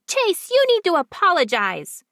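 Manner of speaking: put-on high, loud bossy child scolding; a sister shouting at her brother; girl yelling. A narrator's reading. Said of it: "Amy yells at Chase".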